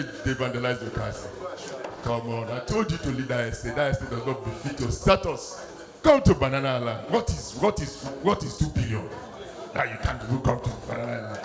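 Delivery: low, lightly voiced smug rich man; mock rich man. Rich man mocking his friend on the phone, low and smug. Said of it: "fellow rich man go dey mock am".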